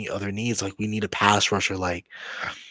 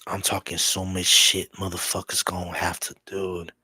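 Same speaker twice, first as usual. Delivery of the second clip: usual speaking voice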